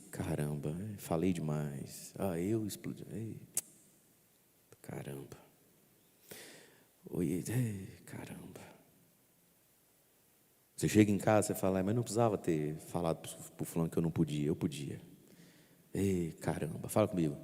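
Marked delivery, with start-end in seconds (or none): 0.1-8.4 hushed, regretful self-reproach fading to a whisper; remorseful muttering. Acted. Priest acting out a remorseful sinner, hushed. Remorseful inner voice after a sin. 11.8-16.9 regretful muttering. Acted. Sinner regretting at home.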